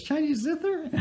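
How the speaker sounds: very high falsetto woman imitation; eager woman's question. Eager buyer greets the seller in the parking lot, mock falsetto question. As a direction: squeak it as a hopeful question, mimicking her. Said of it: "big smile on her face".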